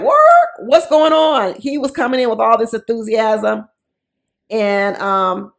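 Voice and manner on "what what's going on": squeaky shocked what, rising high; mock outrage, voice cracking up high; shocked disbelief. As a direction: imitate his stunned confusion. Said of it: "He was like".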